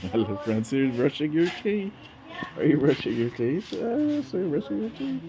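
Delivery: high sing-song cooing at a little one. Real, not acted. Father cooing at his baby girl brushing teeth, high baby talk.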